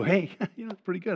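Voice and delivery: light laughing mock-casual shrug-off; mock breezy. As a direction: brush it off with a chuckle. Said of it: "kind of words you go".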